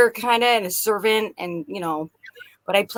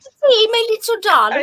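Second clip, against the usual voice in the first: higher, louder cooing character voice; 11 semitones higher, 3 dB louder than usual. Retold or imitated. Mom doting on her little child in a high cooing character voice. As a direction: dote on them in a sweet sing-song. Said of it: "I'd go into characters".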